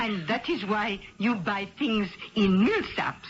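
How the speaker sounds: put-on a Midwestern lady faking a French accent; thick put-on French accent, a bit overdone; phony French accent. Perfume rep posing as French, phony French accent. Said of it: "It was a phony French accent".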